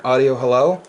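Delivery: steady test count over the air; radio mic check. Real, not acted. Ham operator testing his amp, radio check calls.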